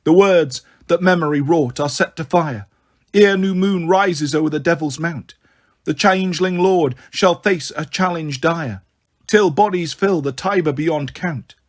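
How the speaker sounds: ordinary delivery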